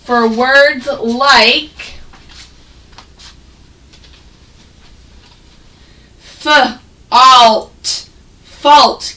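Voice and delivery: fault split into f- and alt, then blended as fault; sounded out. Shown as a demonstration. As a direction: stretch the aw in fault slowly. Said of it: "Can you all say fault".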